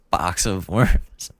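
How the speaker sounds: slow, chuckling "Box of worms"; amused drawn-out "Box of worms"; laughing at own slip. Shown as a demonstration. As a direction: savour the mixed-up idiom "Box of worms" with a laugh. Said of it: "Can of worms".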